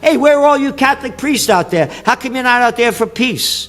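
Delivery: rising heated harangue; heated rant. Real, not acted. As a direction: shout down the preachers of lesser evil. Ranting at priests and voters.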